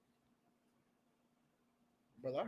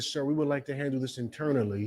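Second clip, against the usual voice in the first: soft official; 2 semitones lower, 8 dB quieter than usual. Retold or imitated. School official placating father.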